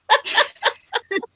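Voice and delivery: high, giggly laugh. Real, not acted. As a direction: laugh in a high run of short bursts. Laughs at the Texas remark.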